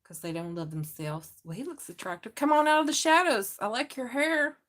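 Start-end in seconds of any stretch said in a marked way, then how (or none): 2.3-4.6 higher and louder playful coax; playful flirt at a pictured man; flirty coaxing. Pretend. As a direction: lure him out teasingly. Flirting with the card figure.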